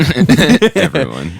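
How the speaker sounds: slow laughing echo. Real, not acted. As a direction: drag the word out laughing.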